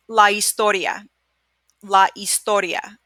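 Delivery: la historia with no h, starting on the ee sound; silent h. Shown as a demonstration. Teacher showing the silent h to students, clear Spanish. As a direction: skip the h in historia. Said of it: "H is silent".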